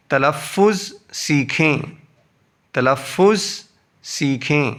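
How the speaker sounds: usual speaking voice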